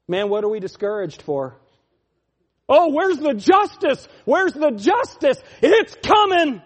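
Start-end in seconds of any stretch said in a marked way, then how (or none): 2.7-6.6 higher, louder outcry; impassioned cry. Acted. Preacher crying out for justice, loud. Mock outcry then threat.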